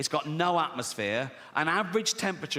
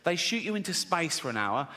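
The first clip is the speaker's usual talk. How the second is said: normal talking voice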